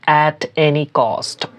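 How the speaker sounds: slow, even model phrase; teacher pronouncing slowly; slow demo. Shown as a demonstration. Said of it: "যে কোনো মূল্যে".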